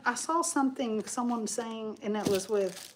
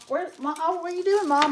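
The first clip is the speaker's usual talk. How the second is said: high, louder call across the room; surprised call to mom; 7 semitones higher, 4 dB louder than usual. Real, not acted. Calling over to mom watching football, surprised. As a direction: catch mom off guard with a raised voice. Calls out to her mom nearby.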